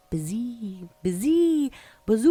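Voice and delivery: eerie storm wind; wind howl. A narrator's reading. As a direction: let the wind build to a howl. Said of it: "the wind blew".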